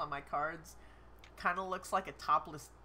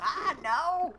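high loud dismayed cry; surprised; 10 semitones higher, 9 dB louder than usual. Real, not acted. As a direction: yelp at a mistake. Mistake on screen.

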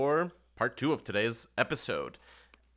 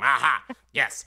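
loud, triumphant imp; 4 semitones higher, 6 dB louder than usual. Acted. Imp announcing the rooms to players, triumphant and loud. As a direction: announce it like a gleeful demon.

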